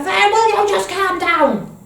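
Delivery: put-on high, loud snapping at the child; exasperated adult. Parent matching the child's meltdown, loud and high exasperation. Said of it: "and we go".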